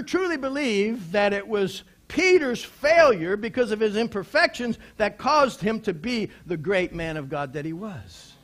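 normal talking voice

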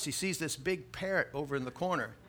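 ordinary delivery

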